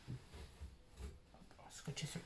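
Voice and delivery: slow whispered aside. Real, not acted. Taping the security tag to the box, slow whisper. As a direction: whisper it slowly to yourself while taping.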